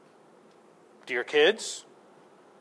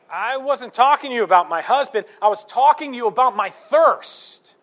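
higher, louder acted objection; indignant protest; 5 semitones higher, 6 dB louder than usual. Woman objecting to Jesus about her thirst, indignant. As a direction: voice an exasperated woman protesting, pitched up.

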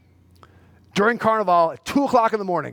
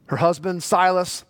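normal talking voice

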